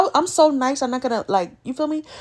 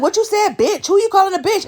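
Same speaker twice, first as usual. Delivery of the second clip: imitating her higher, rowdy comeback; mock bartender yell; 7 semitones higher than usual. Bartender confronting the speaker over the joke, mock angry yell. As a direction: play the nosy bartender snapping back, hammed-up. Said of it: "she's literally screaming".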